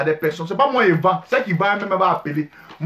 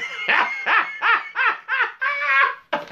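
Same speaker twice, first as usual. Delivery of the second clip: high laugh; 3 dB quieter than usual. Real, not acted. Burst after joking line.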